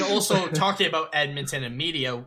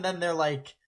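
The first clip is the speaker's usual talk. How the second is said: ordinary delivery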